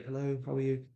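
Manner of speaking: small, hushed greeting; subdued caller. Shown as a demonstration. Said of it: "if they're, if they're like, really".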